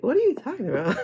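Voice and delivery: high, amused disbelief; laughing retort. Retold or imitated. Answering the card player, a laughing high retort. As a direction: toss it back with a laugh. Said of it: "And I'm like".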